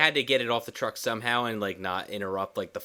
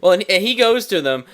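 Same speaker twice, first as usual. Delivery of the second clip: excited; 9 semitones higher, 8 dB louder than usual. Real, not acted. Excited interjection.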